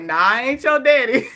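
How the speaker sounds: dad's answer, close to own voice; lightly voiced dad. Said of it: "my dad's like".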